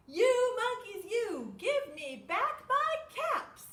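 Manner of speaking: big storybook fury at the monkeys; very angry peddler. Acted. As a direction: really shout it at the monkeys. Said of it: "made the peddler very angry".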